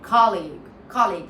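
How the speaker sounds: colleague as KAH-leeg, demonstrated loudly; ah sound. Shown as a demonstration. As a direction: open the first vowel of colleague to ah. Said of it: "ah sound".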